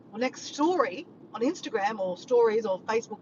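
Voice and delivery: ordinary talk, nothing marked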